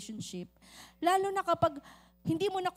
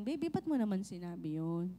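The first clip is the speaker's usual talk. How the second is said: tender reconciling; 8 semitones lower, 3 dB quieter than usual. Acted. Spouse calmly reconciling.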